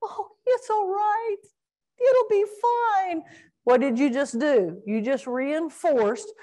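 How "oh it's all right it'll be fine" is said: much higher, slow sing-song soothing; mock coddling owner. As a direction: overdo the comforting of a puppy. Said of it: "walk over to the little guy and go".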